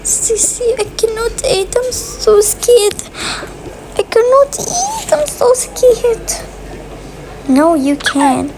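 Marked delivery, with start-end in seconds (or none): 0.0-6.3 scared twin. Pretend. Twin too scared to eat.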